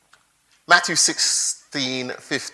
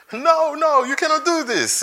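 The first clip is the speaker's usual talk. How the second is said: John protesting; 12 semitones higher than usual. Acted. John refuses to baptize Jesus.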